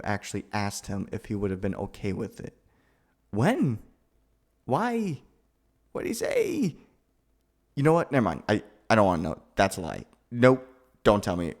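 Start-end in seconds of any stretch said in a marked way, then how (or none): 3.3-6.7 high, urgent question; eager shock. Acted. To the friend who asked the brother, a high startled question. 7.7-11.5 flustered refusal. Acted.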